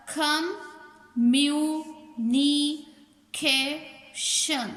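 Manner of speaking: communication in five drawn-out beats, com, mu, ni, ca, tion; teacher sounding out com—mu—ni—ca—tion; split into syllables. Shown as a demonstration. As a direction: say communication very slowly with a gap after every syllable. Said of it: "चार vowel से ज़्यादा की sound".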